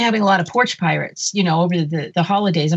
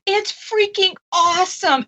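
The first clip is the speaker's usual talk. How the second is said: excited rave; 11 semitones higher than usual. Real, not acted. Movie fan recommending a film to co-hosts, excited. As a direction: rave about it, pitched way up. Gushing about a favourite new movie.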